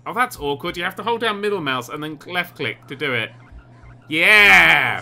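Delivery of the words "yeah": loud, drawn-out "yeah"; triumphant cheer. Real, not acted. Player finishing his graffiti tag, triumphant cheer. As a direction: crow it loud and long.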